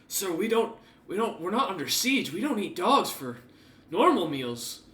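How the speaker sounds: a guard objecting to his boss, a little flustered; protesting guard. Acted. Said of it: "one of them goes".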